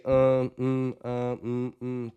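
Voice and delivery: hesitant string of uhs and mms; demonstrating how not to speak, all ums; filler-word demo. Shown as a demonstration. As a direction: stall with empty filler sounds. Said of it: "Don't say shit like that".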